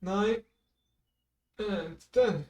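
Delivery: counting squats. Real, not acted. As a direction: call the reps mid-exercise. Counting reps while doing squats.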